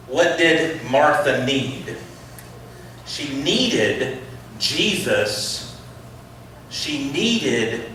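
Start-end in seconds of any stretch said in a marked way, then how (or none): none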